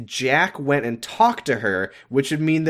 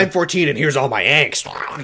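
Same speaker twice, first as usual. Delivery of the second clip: mock teen angst; lightly exaggerated fourteen-year-old; angsty teenager; 3 semitones higher, 3 dB louder than usual. [name] pushing her angst at George, mock teenage angst. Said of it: "she's just like".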